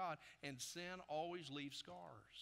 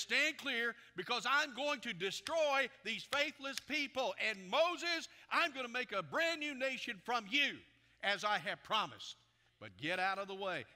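loud, high-pitched preacher's proclamation as God; acted divine wrath at full force; thundering God; 7 semitones higher, 11 dB louder than usual. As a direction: thunder a judgment at Moses. Preacher voices angry God's threat.

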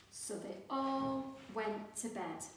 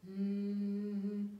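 fridge hum. A narrator's reading. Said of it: "went the refrigerator".

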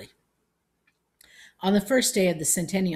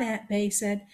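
ordinary delivery, nothing marked

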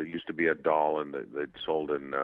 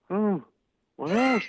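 high mock-awestruck child; amazed kid; 14 semitones higher than usual. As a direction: gasp it like a stunned young fan. Retold awestruck child on phone.

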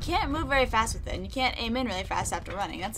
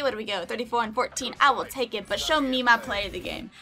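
ordinary talk

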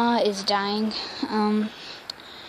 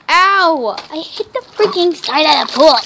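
sudden loud ow, then complaining; pained yelp; 4 semitones higher, 6 dB louder than usual. Real, not acted. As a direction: yelp from a knock. Hits side of the pool.

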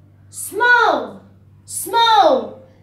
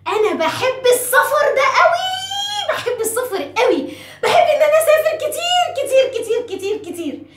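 gushing excitement; 7 semitones higher than usual. Pretend. Teacher confessing she loves travel, gushing excitedly. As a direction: squeal it with playful, exaggerated glee. Gushes about her love of travel.

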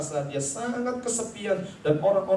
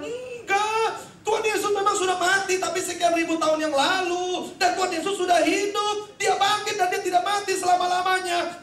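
passionate rebuttal; 12 semitones higher than usual. Retold or imitated. Luther corrects wife passionately.

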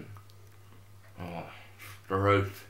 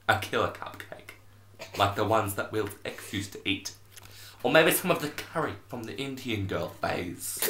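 ordinary delivery

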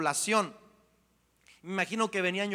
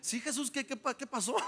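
dead man waking; 5 semitones higher, 5 dB quieter than usual. Retold or imitated. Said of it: "yo me imagino que así fue".

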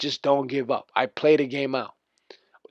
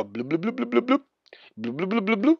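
quick run of bloop noises, rising in pitch; imitated dribbling bloops; 5 semitones higher, 5 dB quieter than usual. Player mocking opponent's dribbling to viewers, imitated bloop noises. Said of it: "the dribbling".

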